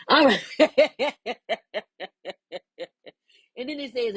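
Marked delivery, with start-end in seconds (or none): 0.1-2.8 laughing. Real, not acted. Laughing at herself.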